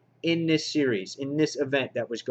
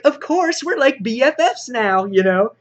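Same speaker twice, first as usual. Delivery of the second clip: high, loud hammed-up chumminess; mock girlish friend; 8 semitones higher, 7 dB louder than usual. Teammate declaring they are best friends, mock gushing. Acted reply of another character.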